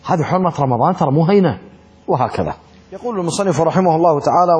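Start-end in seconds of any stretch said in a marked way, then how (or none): none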